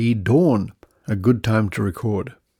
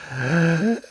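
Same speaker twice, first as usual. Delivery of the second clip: mock gasp; 6 semitones higher than usual. Spots soup in supermarket.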